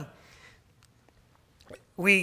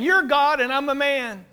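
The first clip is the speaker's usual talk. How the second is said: mock prideful claim; 5 semitones higher than usual. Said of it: "that's a pride issue".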